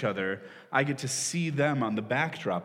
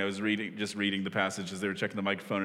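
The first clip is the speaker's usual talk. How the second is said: usual speaking voice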